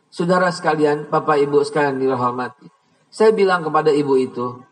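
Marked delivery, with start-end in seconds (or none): none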